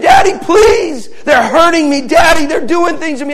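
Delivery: high, loud desperate begging, imitated; screamed pleading. Little girl tells Daddy they're hurting her, screamed pleading. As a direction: cry out to a parent for rescue. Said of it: "she started screaming".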